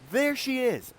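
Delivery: high, loud cry of discovery; excited call. Acted. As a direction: call out like you just spotted her. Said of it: "All, like, in unison".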